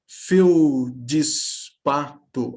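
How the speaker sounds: Feldispato very slow as fel-di-spa-to with an i sound; slow demo of Feldispato with the extra i; spoken syllables with added i. Shown as a demonstration. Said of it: "do ponto de vista sonoro, como fica?".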